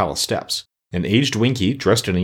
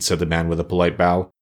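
usual speaking voice

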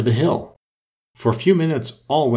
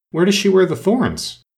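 curious; 9 semitones higher, 4 dB louder than usual. A narrator's reading. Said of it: "Alice asked with some curiosity".

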